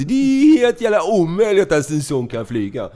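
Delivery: loud, high, drawn-out disbelief; mock panic. Nervous flyer looking at the metal plane, loud slow mock panic. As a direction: wail in hammed-up dread of flying.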